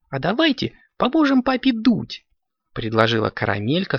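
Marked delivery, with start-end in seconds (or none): 0.1-2.2 put-on high-pitched childlike suggestion; high, eager little-kid voice; eager kitten child. A narrator's reading. Kitten proposes to her brothers.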